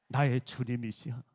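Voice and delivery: low-pitched, respectful salutation; reverent address. Shown as a demonstration. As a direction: address the elders as lords, low. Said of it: "시어머님이 여러분 집안에 들어옵니다". (낮은 음으로 공손하게 부르기)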